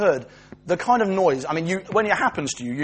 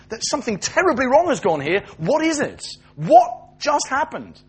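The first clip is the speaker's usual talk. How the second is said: high, incredulous demand for an explanation; bewildered dismay; 7 semitones higher than usual. Acted. Children of Chernobyl asking one another what went wrong, bewildered. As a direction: voice climbing as you ask what went wrong.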